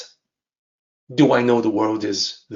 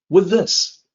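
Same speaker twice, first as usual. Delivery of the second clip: short, emphatic pronouncement, pitched up; brief, higher-pitched emphatic statement; concise and abrupt; 6 semitones higher than usual. Shown as a demonstration. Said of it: "Very concise, abrupt, that's it".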